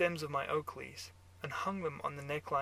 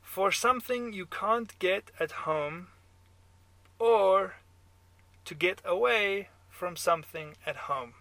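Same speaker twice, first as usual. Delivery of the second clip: put-on loud, higher German-accented pronouncement; 5 semitones higher, 10 dB louder than usual. A narrator's reading. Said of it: "your German accent".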